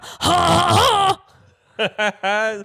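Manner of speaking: loud theatrical fake laugh; big stagey laughter; booming mock laugh. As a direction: bellow a slow, hammed-up ha-ha-ha. Fake laugh ending the bit.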